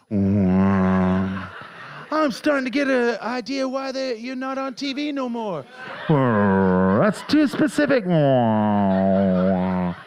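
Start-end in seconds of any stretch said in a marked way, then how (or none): 0.1-1.5 very low, loud drawn wow; deadpan deep wow like a crowd; mock awed wow. Comic drops a deep booming wow. 6.1-9.9 much lower, slower, louder grumble; mock heckler grumble; low slow heckle. Heckler grumbles at the comic, low and slow.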